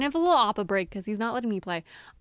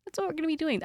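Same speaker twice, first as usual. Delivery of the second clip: voicing the chill dog; 7 semitones higher than usual. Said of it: "He's like".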